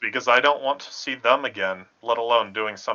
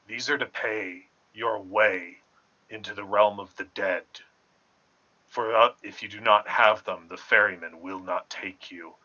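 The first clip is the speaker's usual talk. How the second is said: ominous warning; 5 semitones lower, 5 dB quieter than usual. Acted. Warning the children about the ferryman, low and ominous.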